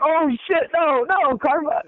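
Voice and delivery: very high yelping dismay; pained panic. Retold or imitated. Retelling his own cramp after mocking a teammate, high pained yelp. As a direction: squeal as the cramp strikes back. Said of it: "It's like".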